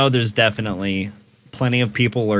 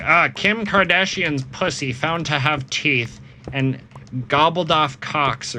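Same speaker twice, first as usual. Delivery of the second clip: higher and slow, hammed-up headline read; mock tabloid headline; 5 semitones higher than usual. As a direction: announce it like a trashy tabloid.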